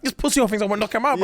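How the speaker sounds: high, rapid mock rant; retold pillow screaming. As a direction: rage in a high voice without a full yell. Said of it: "screaming into my pillow".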